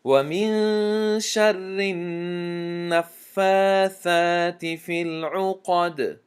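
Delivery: slow melodic tajweed chant, drawn out; Quran recitation. Shown as a demonstration. Teacher recites al-Falaq to students in slow tajweed chant. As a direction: recite each verse slowly, measured and melodic.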